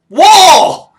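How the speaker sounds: very loud, high holler; playful shout. Pretend. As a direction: yell it in mock alarm. Mock alarm at a wall in game.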